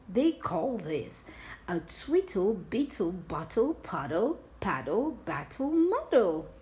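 ordinary delivery, nothing marked

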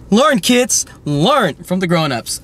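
hammed-up grown-up warning; mock lecturing. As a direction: lecture the kids with mock authority. Mock safety lesson to kids.

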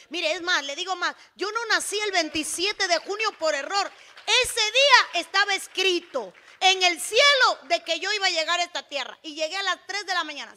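very loud, impassioned testimony; full-force preaching. Real, not acted. Preacher telling the church her birth was written, full-force preaching. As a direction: proclaim your own birth as destined, at full volume.

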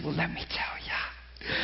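hushed confiding whisper, slow. Retold or imitated. Telling the curious pool crowd his story, in a slow whisper. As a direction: lean in and whisper the secret. He answers poolside questioners.

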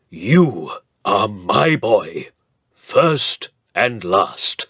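bestow it like a vow; proud old Earl. Acted. Earl telling Cedric he is his boy, solemn.

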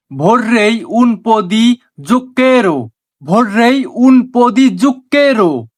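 very slow, word by word Italian phrase; slow teaching demo. Shown as a demonstration. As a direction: spell it out slowly for learners. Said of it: "এর Italian হবে". (ধীরে ধীরে শেখানোর উচ্চারণ)

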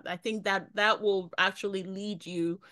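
usual speaking voice

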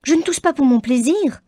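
put-on a cross girl defending herself; Kitty answers her father crossly, high and loud; peevish retort. A narrator's reading. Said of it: "répliqua Kitty avec humeur".

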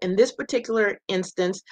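ordinary talk

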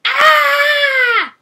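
long, very high scream; ear-splitting horrified scream; piercing shriek. Acted. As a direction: shriek high enough to stun bats. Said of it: "I shrieked, reaching a high enough pitch".

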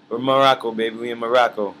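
loud, exultant call-out; excited hype. Real, not acted. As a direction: shout out where you are, hyped. Exulting to viewers about being in Morocco.

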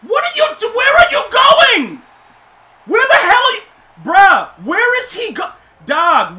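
high, loud, exasperated shouting at the screen; furious yelling. Real, not acted. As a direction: scream at your useless player in disbelief. Yelling at his player on screen.

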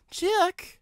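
Riley searching for Chuck at night; calling out. A narrator's reading. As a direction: call into the dark. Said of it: "she called into the night".

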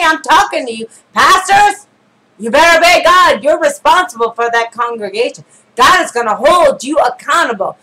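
emphatic, driving sermon warning; urgent pulpit admonishment; forceful preaching. Real, not acted. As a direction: preach it hard at the pastors, punching key phrases.

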